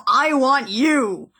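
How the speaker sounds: slow, drawn-out poster pitch; Uncle Sam. Retold or imitated. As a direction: point and drawl like Uncle Sam. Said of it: "like, Uncle Sam".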